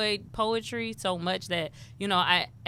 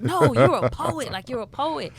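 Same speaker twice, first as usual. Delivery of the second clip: insistent friend; 5 semitones higher, 7 dB louder than usual. Retold or imitated. Friends insisting she is a poet.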